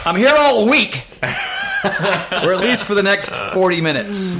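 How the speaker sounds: high, very loud stage shout; big joking showman's holler; mock comic sign-off. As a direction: belt it out like a hammed-up club comic.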